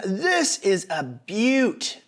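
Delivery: slow, rough attempt at an Australian accent; Steve Irvin. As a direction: draw it out in a half-hearted Australian accent. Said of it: "in a better accent than that".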